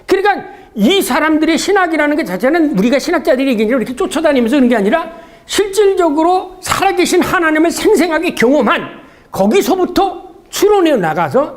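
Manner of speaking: ordinary delivery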